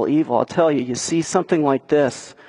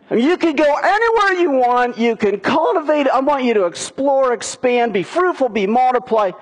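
generous God voice; 9 semitones higher than usual. Retold or imitated. God telling Adam and Eve, high and generous. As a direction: voice God's open-handed offer.

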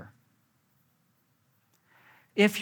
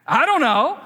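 high, louder sulky mock answer; sulky husband; 10 semitones higher, 8 dB louder than usual. Husband dodging his wife's question, whiny mock reply. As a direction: whine it like a stubborn kid.

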